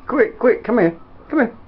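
high, loud echo of a call; excitable high-pitched calling; urgent mimicked summons. Echoing the other's urgent summons.

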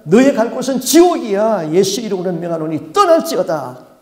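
a preacher thundering the final command; exorcism command. Retold or imitated. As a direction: order the demon out in Jesus' name. Pastor commands demon out again.

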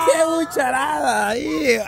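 high, drawn-out cry of amazement; long squealed reaction; disgusted exclamation. Real, not acted. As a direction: cry out at a gross sight, high-pitched. Reacting to friend vomiting.

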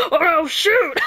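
loud commanding cop voice, laughing; high, loud cop order, cracking up; mock tough cop. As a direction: bark it like a trigger-happy cop. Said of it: "you're like".

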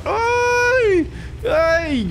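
real startled "ay, ay"; alarmed cries. Real, not acted. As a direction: cry out as the shot nearly goes wrong. Teammate nearly dies on shot.

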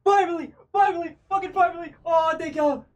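triumphant high cheering; elated shouting. Real, not acted. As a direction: yell out the relief. Celebrating the drop.

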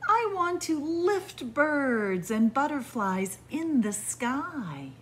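slow, lilting storybook read; reading to children. Acted. Storyteller reading the windy-day book to kids, lilting. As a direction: savour each image for little listeners.

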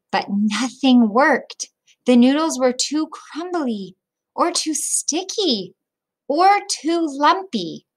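put-on reading a picture book aloud to children; children's story read-aloud; storybook narration. A narrator's reading. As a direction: tell it gently, like story time.

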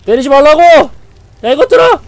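shouting stop hitting me; furious yell. Real, not acted. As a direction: yell in rage at them. Yelling at enemies. (분노의 고함)

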